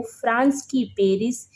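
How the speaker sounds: usual speaking voice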